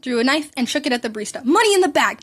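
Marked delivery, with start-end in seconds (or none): 1.4-2.2 robber's demand. A narrator's reading. Robber threatens the barista.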